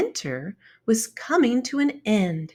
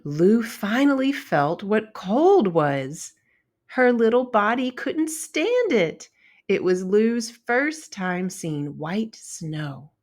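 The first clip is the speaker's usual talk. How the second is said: ordinary delivery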